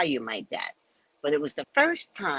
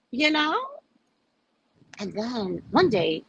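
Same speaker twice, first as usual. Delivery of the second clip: ordinary delivery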